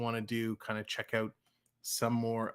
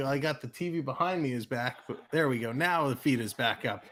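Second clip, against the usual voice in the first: ordinary talk, nothing marked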